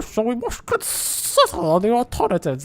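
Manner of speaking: mock slow, high alien drawl; hammed-up underwater creature voice; slow deep creature voice. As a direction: stretch each word like a fish-person speaking. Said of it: "That's not good".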